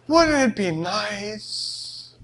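slow, soft wistful hint; drawn-out longing suggestion; dreamy wife. Retold or imitated. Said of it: "You know how it goes".

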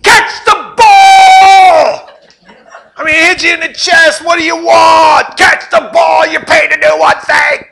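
high, loud, drawn-out angry yell; mock Tom Brady scream. Quarterback screaming at his receivers, furious. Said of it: "He's screaming at people".

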